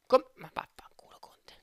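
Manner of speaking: whispered furious swearing; explosive italian cursing. Real, not acted. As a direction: spit the curse in a whisper. Cursing rejected deal. (bestemmia sussurrata furiosa)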